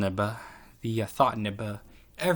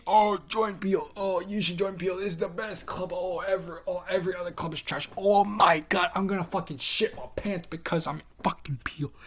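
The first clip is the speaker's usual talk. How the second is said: much higher, far-off mocking fanboy voice; mocking club fans; 9 semitones higher than usual. Mocking the Peel kids who shill the club, whiny high fanboy voice. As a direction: parody the hyped recruiters in a whiny high voice.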